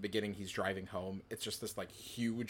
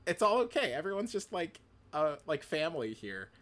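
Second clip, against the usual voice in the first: delighted; 6 semitones higher than usual. Retold or imitated. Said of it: "And I would be like".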